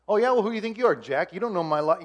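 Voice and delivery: mock-indignant retort; defensive. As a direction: snap back as if offended. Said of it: "I'll get defensive".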